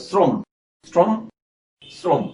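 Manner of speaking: শ্রম said as "srom", with a plain s; separate, careful শ্রম starting on s, not sh; English-S pronunciation. Shown as a demonstration. Teacher modelling শ্রম for students with an English s. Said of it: "ইংরেজি এস বর্ণের মতো উচ্চারণ".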